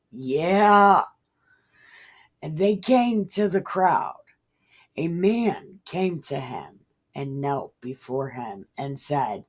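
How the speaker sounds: ordinary talk, nothing marked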